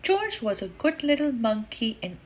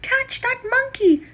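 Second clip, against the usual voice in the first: much higher shouted alarm; yell; 12 semitones higher, 4 dB louder than usual. Acted. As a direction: shout across the park in alarm. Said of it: "someone yelled".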